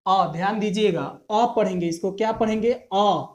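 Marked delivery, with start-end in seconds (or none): none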